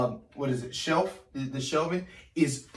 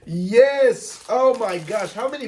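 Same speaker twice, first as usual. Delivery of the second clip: excited shout; 8 semitones higher, 5 dB louder, slower than usual. Real, not acted. Thrilled at opening set.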